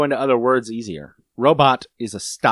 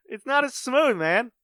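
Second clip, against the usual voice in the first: normal talking voice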